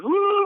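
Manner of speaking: high-pitched, gleeful "woo"; celebratory high cheer; excited whoop. Real, not acted. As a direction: let out a delighted high whoop.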